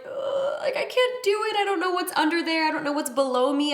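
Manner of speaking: high-pitched mock panic, easing down; squeaky replay of your own panicked whining; retold panic. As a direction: act out freaking out, high and hurried. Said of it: "I started panicking".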